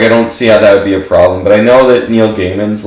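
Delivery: normal talking voice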